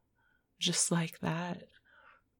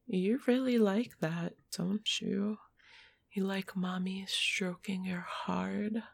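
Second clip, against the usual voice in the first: ordinary talk, nothing marked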